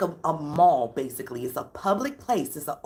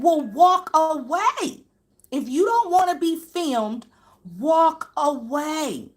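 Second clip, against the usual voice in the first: loud, high, slow emphatic command; retold shouted retort; 8 semitones higher, 5 dB louder, slower than usual. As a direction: act out him firmly sending them off. Acting out the heated argument.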